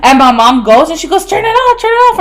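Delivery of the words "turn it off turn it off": urgent mom shout. Retold or imitated. Mom shouting about flooding.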